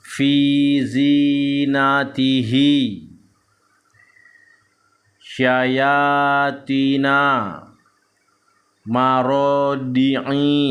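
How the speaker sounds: slow, drawn-out Arabic syllables on an even tone; measured Quran-reading demonstration; Iqra recitation drill. Shown as a demonstration. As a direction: chant each syllable long and steady. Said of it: "di tenggorokan".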